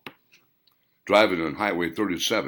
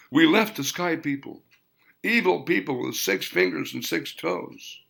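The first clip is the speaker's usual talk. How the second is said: higher, lightly voiced old woman; grandmother telling legend; 8 semitones higher than usual. A narrator's reading. Grandmother telling Williams of the sky people, higher old voice. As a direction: hand down the old story gravely.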